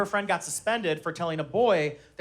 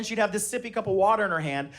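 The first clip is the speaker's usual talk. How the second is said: normal talking voice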